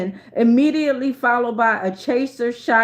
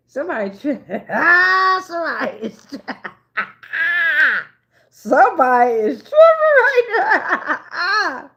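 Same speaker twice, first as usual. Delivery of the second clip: laughing through words, high and loud; helpless giggling, slow; 6 semitones higher, 3 dB louder than usual. Real, not acted. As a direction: crack up while trying to say it. Cracking up at reading.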